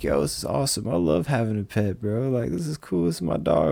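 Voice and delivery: high, delighted retelling; thrilled new dog owner. Retold or imitated. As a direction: gush over the first pet. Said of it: "I'm like".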